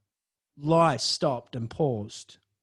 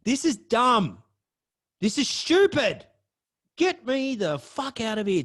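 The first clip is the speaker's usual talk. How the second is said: exasperated; 5 semitones higher than usual. Retold or imitated. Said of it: "I'm thinking".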